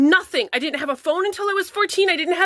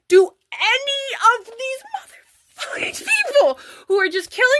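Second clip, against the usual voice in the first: furious hissed rant; 7 semitones higher than usual. Real, not acted. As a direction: spit each word at the people hurting you. Ranting at critics.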